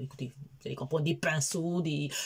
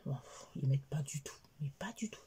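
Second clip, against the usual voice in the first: breathy whispered complaint; whispered aside; 4 semitones lower, 9 dB quieter than usual. Real, not acted. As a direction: drop to a whisper, half joking. Aparté chuchoté sur ses fils. (aparté chuchoté)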